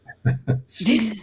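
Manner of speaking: short amused laugh; light laugh. Real, not acted. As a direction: laugh off the overlap. Awkward overlap, both yielding.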